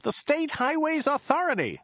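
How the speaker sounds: high, mildly baffled repeat; high driver voice, puzzled; a bit flummoxed. A narrator's reading. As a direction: repeat it high-pitched, slightly thrown. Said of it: "a bit flummoxed".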